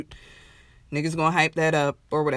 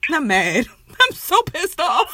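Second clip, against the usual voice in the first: half-laughing mock fury; laughing while mad; 9 semitones higher than usual. Real, not acted. Venting to cohost about the concert, laughing. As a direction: laugh through it, higher and amused.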